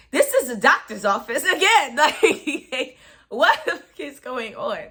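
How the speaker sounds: slow, laughing what is going on; laughing disbelief. Retold or imitated. To the doctor, laughing in disbelief. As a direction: laugh through the words in disbelief.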